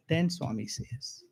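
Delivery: ordinary talk, nothing marked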